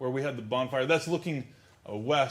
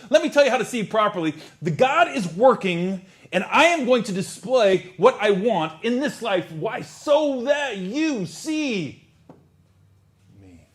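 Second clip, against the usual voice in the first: bold proclaiming Jesus; 5 semitones higher, 9 dB louder, slower than usual. Acted. Preacher voicing Jesus reframing.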